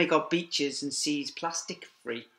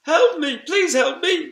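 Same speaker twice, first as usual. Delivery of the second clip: high, loud cry for help; trapped whale calling for help; 11 dB louder than usual. A narrator's reading. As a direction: call out from the water, pleading. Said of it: "called a voice from the sea".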